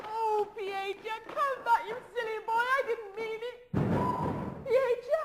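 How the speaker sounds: high, pleading call; calling after him. Acted. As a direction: call him back, coaxing. She calls him back.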